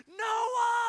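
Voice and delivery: high, loud angry yell of a name. Retold or imitated. Frustrated dad yelling at his two-year-old, loud high yell. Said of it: "eventually I just yell".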